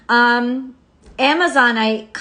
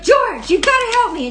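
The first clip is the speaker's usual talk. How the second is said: high, loud panicked plea; retold panic, pitched way up; mock freaking out; 9 semitones higher, 5 dB louder than usual. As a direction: act out a frantic cry for help. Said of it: "And she's freaking out".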